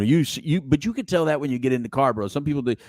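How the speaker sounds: ordinary talk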